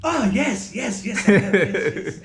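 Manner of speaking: high, loud, excitable impression; Moody's eccentric voice. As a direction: crow a gleeful string of yeses. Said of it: "he has a fucking really eccentric voice".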